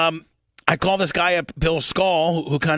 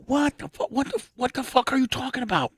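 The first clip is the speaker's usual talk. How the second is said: high, stunned what the fuck, pitched way up; disbelieving outburst; 11 semitones higher, 3 dB quieter than usual. Retold or imitated. Hearing about a thirty grand job, high disbelieving squeal.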